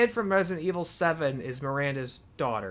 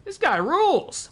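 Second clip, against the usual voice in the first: excited; 7 dB louder than usual. Real, not acted. Delighted at a new character.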